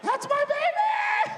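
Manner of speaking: very high, slow, loud falsetto squeal; squealing delight. Acted. Dad melting over his little daughter, very high slow squeal. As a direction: gush like a swooning fan.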